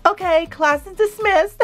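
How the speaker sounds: high, sing-out dismissal; teacher dismissing class. Acted.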